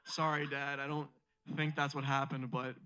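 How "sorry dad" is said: normal talking voice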